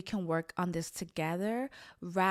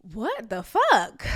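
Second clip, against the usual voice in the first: stunned outburst; 4 semitones higher, 9 dB louder, slower than usual. Retold or imitated. Blurting out her disbelief.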